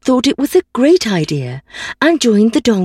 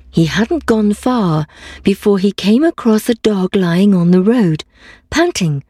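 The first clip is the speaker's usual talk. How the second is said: plain reading voice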